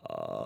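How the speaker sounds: big open yawn sliding low to high; yawning sigh. Shown as a demonstration. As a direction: yawn it out, smooth and wide. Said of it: "let's just have a big yawn for as low as you can to as high as you can".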